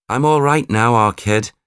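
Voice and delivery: high, loud Scouse call of relief; cheeky Scouse shout. A narrator's reading. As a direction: shout it across the stage in a Scouse accent. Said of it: "shouted Carl".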